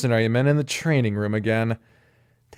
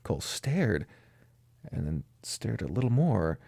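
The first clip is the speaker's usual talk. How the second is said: ordinary delivery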